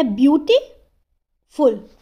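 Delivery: Beautiful slowly split, gap after beauty; beautiful in two separated parts; pause mid-word. Shown as a demonstration. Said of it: "हम बोल कैसे रहे हैं".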